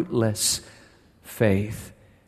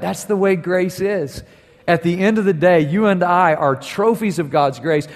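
raised, higher sermon voice with punched key words; impassioned preaching; 6 semitones higher, 5 dB louder than usual. Real, not acted. Preacher telling the church they are trophies of grace, impassioned. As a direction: preach it out to the whole congregation.

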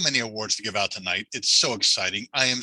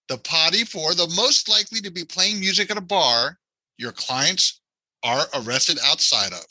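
ordinary delivery

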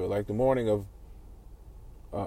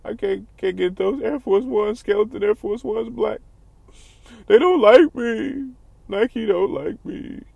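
mock whiny crier; 9 semitones higher than usual. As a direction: whine like a crybaby who can't get sneakers.

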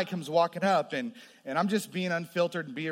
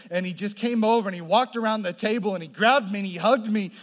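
ordinary talk

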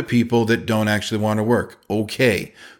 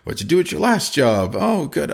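higher, slow, stiff small talk; awkward interviewer; 5 semitones higher than usual. Retold or imitated. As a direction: play a clumsy interviewer making small talk. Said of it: "It's just awkward to me to be like".

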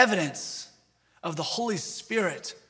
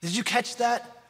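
normal talking voice

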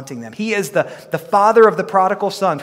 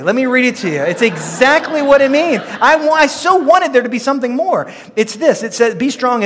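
exasperated outburst; 8 semitones higher, 7 dB louder than usual. Real, not acted. Comic disappointment at simple meaning.

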